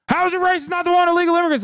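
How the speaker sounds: extremely high, fast squealed imitation; mocking whine. Streamer mimics a supportive fan who turned, fast high mocking whine. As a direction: rattle it off as a squeaky mock complainer.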